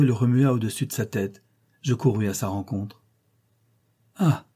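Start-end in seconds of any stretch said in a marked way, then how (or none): 4.2-4.5 short, high-pitched cry of greeting; exclaimed. A narrator's reading. Rider reaching down to Jane as she runs up, exclaiming. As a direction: exclaim it high on meeting her. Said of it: "s'écria-t-il en me tendant la main".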